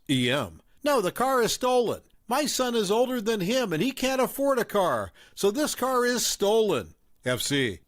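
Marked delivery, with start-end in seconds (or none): 0.8-6.9 higher-pitched accusing mother; raised mom voice. A narrator's reading. Mom confronting the narrator at the fast food place, accusing. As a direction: play the suspicious mom, pitched up.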